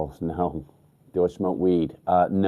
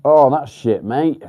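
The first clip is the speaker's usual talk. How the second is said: higher and louder sympathetic outburst; dismayed exclamation; 8 semitones higher, 10 dB louder than usual. Real, not acted. To a viewer sharing bad news, a loud dismayed oh. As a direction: blurt it out, genuinely dismayed for them.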